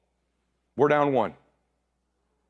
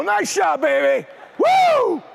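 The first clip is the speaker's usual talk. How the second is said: high, loud whooping celebration; exuberant cheer; 5 dB louder, much slower than usual. Retold or imitated. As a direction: shout it and break into a whoop. Dad celebrates great shot.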